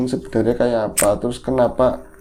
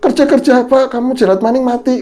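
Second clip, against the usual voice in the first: high, loud scolding; scolding grandma; 12 semitones higher, 7 dB louder than usual. Retold or imitated. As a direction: snap at him in dialect. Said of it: "katanya begitu". (nenek memarahi dengan suara tinggi dan keras)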